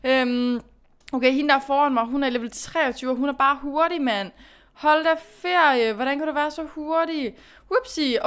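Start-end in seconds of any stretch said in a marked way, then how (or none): none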